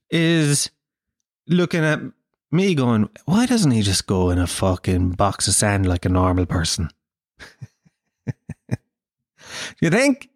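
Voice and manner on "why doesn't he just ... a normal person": judgmental cat. Acted. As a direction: sink into a low, disapproving grumble about the owner. Comedian voices cat judging him.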